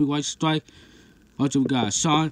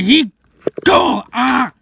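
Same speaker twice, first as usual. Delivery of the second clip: high, loud mock attack shout; battle yell; 9 semitones higher, 8 dB louder, slower than usual. Monster attacking Mechagodzilla in play, yelling. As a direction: yell it out as you charge.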